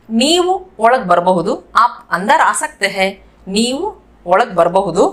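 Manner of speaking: normal talking voice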